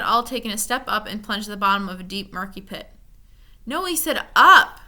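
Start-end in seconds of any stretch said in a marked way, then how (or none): 3.6-4.8 loud, high, slow complaint; bitter complaint. A narrator's reading. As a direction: protest bitterly from the pit. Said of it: "Milo complained bitterly".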